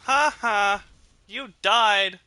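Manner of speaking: loud high mocking laugh and taunt; gloating. Real, not acted. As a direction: rub the kill in their face. Gloating after a kill.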